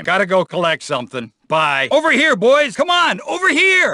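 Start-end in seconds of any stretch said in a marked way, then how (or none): 1.9-3.9 calling out. Acted. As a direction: summon the others from across the yard. Calling other cops over.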